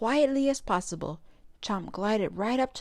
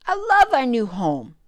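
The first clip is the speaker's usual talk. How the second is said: louder, bright exclamation; exclaiming; 4 semitones higher, 8 dB louder than usual. A narrator's reading. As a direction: burst out with joy. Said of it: "Chomp exclaimed".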